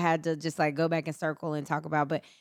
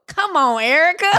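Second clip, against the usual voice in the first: loud high exasperated plea; 10 semitones higher, 9 dB louder than usual. Pretend. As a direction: plead with her, louder and higher. Mock exasperation written in notes.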